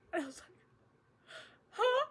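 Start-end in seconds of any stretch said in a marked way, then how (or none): 1.7-2.1 confused huh. Retold or imitated. Confused at being called Daddy Pig.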